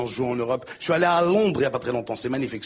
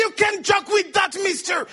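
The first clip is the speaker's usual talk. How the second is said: angry guard shout; 11 semitones higher than usual. Acted. Airport guard scolding the comic, high stern shout. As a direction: bark the rule at him.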